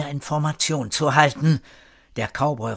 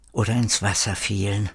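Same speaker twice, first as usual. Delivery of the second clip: usual speaking voice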